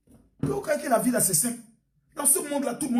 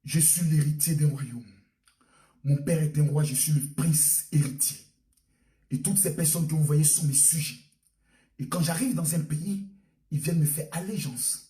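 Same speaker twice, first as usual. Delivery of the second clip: much lower, grand voice of a self-styled crown prince; boastful prince; 8 semitones lower than usual. Acted. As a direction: play the prince bragging to the neighbour. Voicing the self-proclaimed prince.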